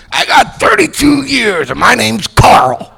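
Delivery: high, slow, angry old-timer impression; Carl. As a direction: announce it in mock belligerence. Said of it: "We had this guy".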